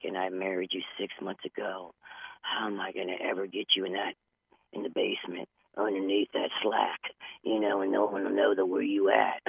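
low, soft grumbled reply; quiet mock threat to the spouse; muttered comeback. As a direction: grumble it half under your breath. Retorting to spouse after insult.

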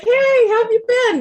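high, loud imitation of friends catching up; mock chatty greeting. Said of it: "They're like".

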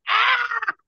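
sudden high-pitched yelp of pain; retold shout. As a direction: cry out sharply, very high. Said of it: "And I'm like".

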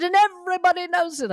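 high, loud, quick mock Cockney; bad Cockney accent. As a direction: rattle it off in badly faked Cockney. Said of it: "I can't do a good Cockney accent".

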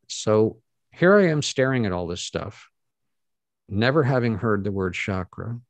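ordinary talk

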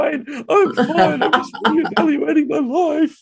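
high, slow, weepy insisting; mock tearful crying. To the barista, tears streaming, crying that he's fine. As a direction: sob out that you're fine. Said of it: "tears streaming down my face".

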